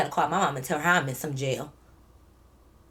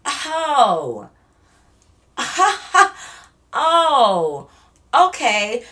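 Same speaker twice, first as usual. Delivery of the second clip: stunned surprise; 5 semitones higher, 6 dB louder, much slower than usual. Real, not acted. Mother drives off, stunned.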